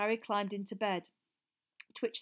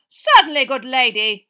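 put-on loud, hearty old knight; cheery knight cry; 5 semitones higher, 20 dB louder than usual. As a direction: proudly call out the answer. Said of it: "cried Sir Cadogan".

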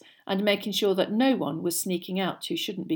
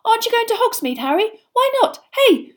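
put-on eager young fan; 9 dB louder than usual. A narrator's reading. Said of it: "Colin looked eagerly around".

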